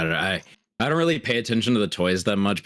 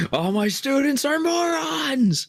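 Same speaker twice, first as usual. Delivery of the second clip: angry teacher; slower than usual. Pretend. Mock teacher rant.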